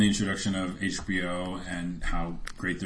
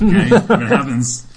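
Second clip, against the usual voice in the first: excited laugh; 11 semitones higher, 12 dB louder than usual. Real, not acted. Calling his own prediction, laughing.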